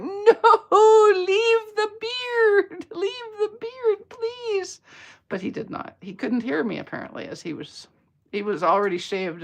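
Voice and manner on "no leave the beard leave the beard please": high, drawn-out hammed-up whine; mock pleading wail. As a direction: wail it like a heartbroken fan, exaggerated. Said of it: "I was like".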